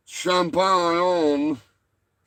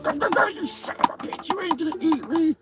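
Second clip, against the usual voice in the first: squeaky high puppet squawk; high squeaky turkey puppet; 13 semitones higher, faster than usual. Acted. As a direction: screech it like a frantic little turkey.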